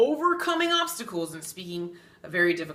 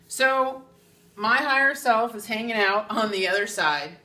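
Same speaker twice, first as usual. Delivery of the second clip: ordinary talk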